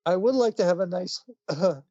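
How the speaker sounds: put-on high, pleading child voice breaking into laughs; hammed-up dying child, cracking up; pitiful blind kid. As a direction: sickly kid asks Santa for a gift for mom. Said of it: "Little blind kid ... says".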